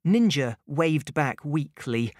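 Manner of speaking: ordinary delivery, nothing marked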